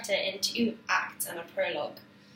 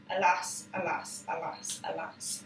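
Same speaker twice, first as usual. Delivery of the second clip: each alas softer, ending in a whisper; fading alas; 3 semitones lower, 5 dB quieter than usual. Retold or imitated. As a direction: let the alas die away. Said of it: "the chorus sings".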